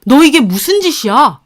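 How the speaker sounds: loud, appalled scolding; shocked, accusing outburst; outraged mother-in-law. A narrator's reading. Shocked in-law sees money thrown. (기막혀 호통치는 시어머니)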